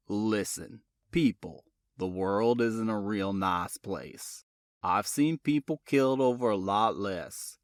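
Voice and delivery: a calm, apologetic detective; deep-voiced sheriff type, polite; southern detective. A narrator's reading. Boone's voice.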